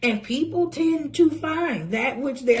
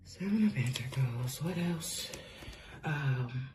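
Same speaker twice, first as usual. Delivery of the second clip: low murmur; 9 semitones lower, 11 dB quieter than usual. Real, not acted.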